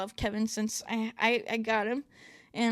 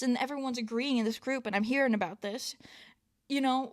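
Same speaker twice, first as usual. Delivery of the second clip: ordinary talk, nothing marked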